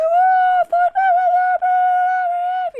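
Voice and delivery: high, drawn-out starstruck gasp; high, slow mock fan awe; awed crowd. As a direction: gush like a starstruck fan seeing a celebrity. Crowd gawking at Floyd Mayweather.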